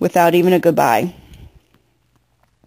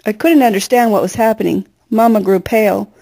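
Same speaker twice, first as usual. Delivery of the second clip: plain reading voice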